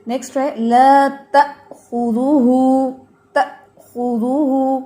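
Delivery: slow tajweed demo; short fatha. Shown as a demonstration. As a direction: keep the ta in تأخذہ short. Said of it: "تا کے زبر کو لمبا نہیں ہونے دینا".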